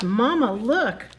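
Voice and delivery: थोड़ी ऊँची और तेज़ भालू के बच्चे की पुकार; भालू के बच्चे की तरह माँ को पुकारो; baby bear voice. Acted. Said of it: "Baby bear peeks outside and says".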